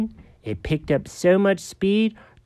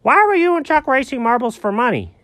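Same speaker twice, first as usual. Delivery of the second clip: ordinary delivery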